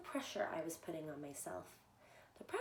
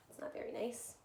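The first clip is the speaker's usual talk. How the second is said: plain reading voice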